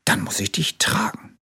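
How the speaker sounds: soft whispered resolve; hushed little bear voice. A narrator's reading. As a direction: whisper it tenderly to the friend. Bear decides to carry tiger.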